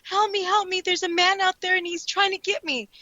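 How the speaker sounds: high, pleading cry for help, mildly acted; imitating a scared girl. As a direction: retell the girl begging through the door. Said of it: "and she's like".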